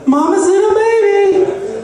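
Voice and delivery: high, drawn-out cooing mommy voice; slow, high-pitched mock baby talk. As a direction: coo it like a mother to her toddler, hammed-up. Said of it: "talking baby talk to him".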